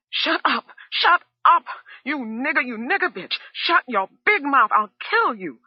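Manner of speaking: very high, loud furious screaming; shouting. A narrator's reading. Wife held back by Jadine, furious screaming. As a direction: scream her down in rage. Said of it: "who was shouting".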